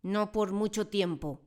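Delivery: loud, slow roar; slow growled roar. Acted. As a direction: roar it back with a nasty grin. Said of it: "rugió la señorita Trunchbull".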